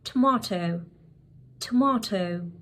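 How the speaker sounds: "tomato" as tuh-MAH-toh; British pronunciation. Shown as a demonstration. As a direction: say "tomato" with a long ah. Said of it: "British English that might be possibly pronouncing wrong".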